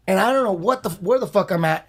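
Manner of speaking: ordinary talk, nothing marked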